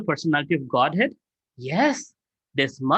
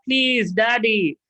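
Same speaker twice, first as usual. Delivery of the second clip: put-on slow, higher coaxing child; pleading child; 6 semitones higher, much slower than usual. Child Krishna coaxes his father to worship Govardhan, slow and pleading. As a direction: stretch it out like a begging little boy.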